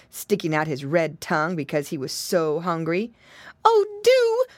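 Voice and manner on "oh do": high, loud plea; pleading. A narrator's reading. Pig mother to the wolf, pleading. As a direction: implore him in a high pig-lady voice. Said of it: "pleaded Missus Twistytail".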